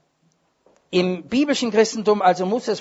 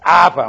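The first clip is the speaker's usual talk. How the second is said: aber thrown out loud with a falling tune; loud punched aber; 10 dB louder than usual. Retold or imitated. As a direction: hit aber hard as the turn in the sentence. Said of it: "und dann kommt's".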